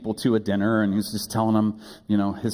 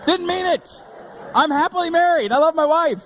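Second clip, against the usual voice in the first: flustered laugh; 9 semitones higher than usual. Real, not acted. Pastor correcting his funeral slip, flustered and high. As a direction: cover the slip in a rush.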